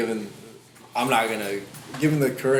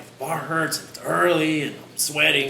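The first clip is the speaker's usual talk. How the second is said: whiny complaining; 6 semitones higher, 4 dB louder than usual. Retold or imitated. Mock complaining at the gym.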